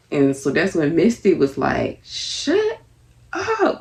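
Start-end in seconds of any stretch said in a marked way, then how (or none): 2.0-3.8 high, drawn-out squeaky order; a cartoonish high-pitched shut up; mock shrill shut up. Misty ordering them to stop.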